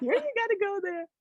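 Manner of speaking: put-on high, fast baby talk to a pet; doting wife. Comedian's wife fussing over the dog, high baby talk. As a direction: coo at the naughty dog like a baby. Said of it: "and my wife is like".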